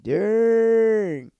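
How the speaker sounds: high, loud, stretched-out exclamation; drawn-out dang. Acted. Recapper reacting to Peyton playing Luke, mock-stunned. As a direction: hold it long and loud like a comic alarm.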